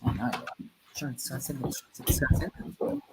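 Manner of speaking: very low, hushed half-voiced mumble; muttering. Real, not acted. Host talking to himself before the piano game, muttering. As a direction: mutter to yourself, barely audible. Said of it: "'cause I'm muttering".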